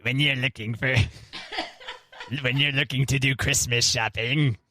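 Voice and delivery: lightly spooky ad read, laughing; a hint of a haunted-house announcer. Shown as a demonstration. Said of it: "just out of nowhere be like".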